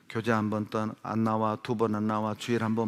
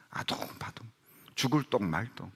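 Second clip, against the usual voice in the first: whisper; 8 dB quieter than usual. Acted. Mimics frantic struggling.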